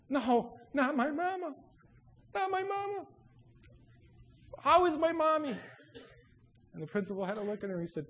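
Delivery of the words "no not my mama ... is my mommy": high, pleading disbelief; dramatized panic, pitched way up; acted distress. Girl told of mother's accident, in high acted distress.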